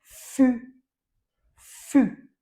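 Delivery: fu called out loud, pitch up; teacher modelling fu; loud model syllable. Shown as a demonstration. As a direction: sound out fu loudly for the class. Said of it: "ce qui va nous donner".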